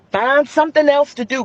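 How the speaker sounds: warning the fellas, loud and high; loud call-out. Real, not acted. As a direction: scold the men loudly. Calling out to men.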